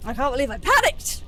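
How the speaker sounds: high, loud disbelief at oneself; exasperated shout. Real, not acted. Player regretting a fatal panic, exasperated shout. Berating self after dying.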